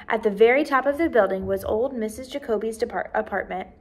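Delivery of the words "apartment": plain reading voice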